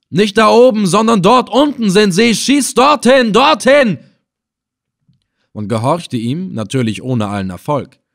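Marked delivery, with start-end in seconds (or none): 0.1-4.0 high, loud barked orders; shouted command. Acted. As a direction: command the men to fire there. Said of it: "Ibn Assel gebot". (laut und hoch befehlen)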